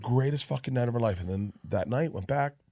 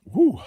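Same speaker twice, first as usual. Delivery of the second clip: whoo; 10 semitones higher, 7 dB louder than usual. Retold or imitated. Sudden numbing rush retold.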